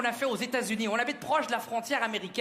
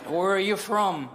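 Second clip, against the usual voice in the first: low, slow official in English; stern American officer voice; American border officer; 3 semitones lower, 4 dB louder, slower than usual. Acted. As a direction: question slowly and low like a customs officer. Border officer questions father.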